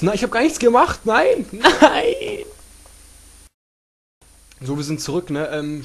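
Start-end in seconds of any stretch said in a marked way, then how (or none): 0.0-2.4 panicked high shouting. Real, not acted. Attacked again, panics.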